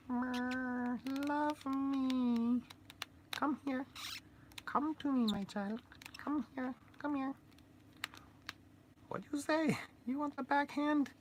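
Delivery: very high squeaky menacing little man; a tiny creep luring you in, high-pitched; high squeaky voice of a sinister little doll; creepy toy voice. Acted.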